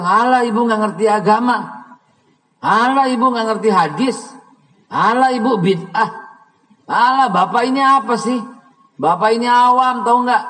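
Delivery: high, loud sneering mimic of a know-it-all teen; mocking arrogant child. Mother retelling her son's sneering, mocked in a high, loud voice. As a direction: sneer at mother like a cocky kid. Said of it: "saya mau begini dibilang".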